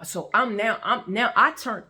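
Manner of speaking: ordinary delivery, nothing marked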